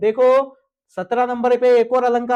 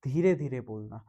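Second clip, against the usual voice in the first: hushed demonstration; softly spoken; 10 semitones lower, 11 dB quieter than usual. Shown as a demonstration. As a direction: drop to a soft hush. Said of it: "शांत स्वर का मतलब".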